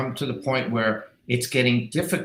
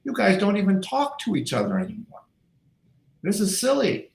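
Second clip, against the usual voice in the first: lifted high scolding voice; mocking scolding; 10 semitones higher than usual. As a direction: scold them like silly kids. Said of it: "I go".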